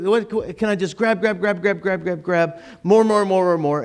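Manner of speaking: acted, grasping repetition; greedy grabbing. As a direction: pile up the words hungrily.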